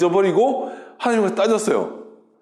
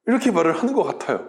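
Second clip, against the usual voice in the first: ordinary delivery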